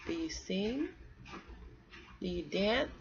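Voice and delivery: ordinary delivery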